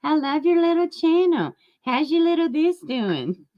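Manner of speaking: high, sugary fake compliment; fake-sweet teasing compliment; mock sweet voice. Shown as a demonstration. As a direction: gush like a patronizing friend. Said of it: "but I do the same shit".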